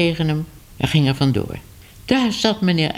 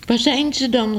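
calling out; 7 semitones higher than usual. A narrator's reading. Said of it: "riep Minoes".

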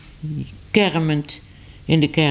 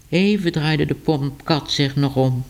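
ordinary delivery, nothing marked

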